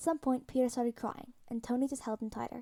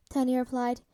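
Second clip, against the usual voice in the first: usual speaking voice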